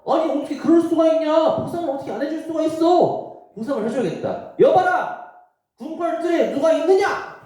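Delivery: the king can't believe no reward was given; indignant king. Acted. King shocked, demands reward. (높고 크게 놀라 따지는 왕)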